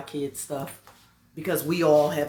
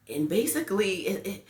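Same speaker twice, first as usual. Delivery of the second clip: normal talking voice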